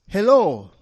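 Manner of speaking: a hint of a small girl greeting; child's voice. A narrator's reading. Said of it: "a child's voice said".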